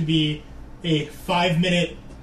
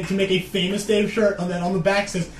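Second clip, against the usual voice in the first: usual speaking voice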